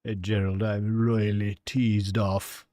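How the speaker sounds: slow, drawn-out mock British accent, stretching teased; hammed-up British accent for Gerald; slow, mock-posh British. Shown as a demonstration. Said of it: "a very British way of saying it".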